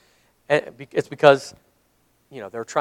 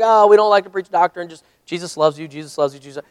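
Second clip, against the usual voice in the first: mocking other churches. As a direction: parrot their excuse, then let it fade. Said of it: "when you're talking to some churches, they're like".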